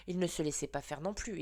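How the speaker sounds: ordinary delivery, nothing marked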